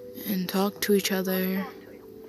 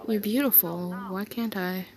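ordinary talk

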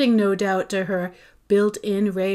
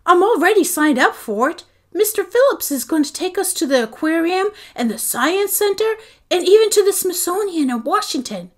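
upset protest; 9 semitones higher, 7 dB louder than usual. A narrator's reading. Boy protests to mom.

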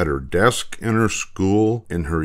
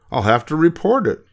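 flustered teacher; 8 semitones higher, faster than usual. Acted. Flustered teacher decides to report.

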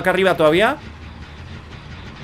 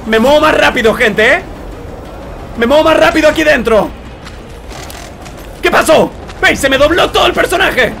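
astonished exclaiming; 5 semitones higher than usual. Real, not acted. Amazed by a game glitch.

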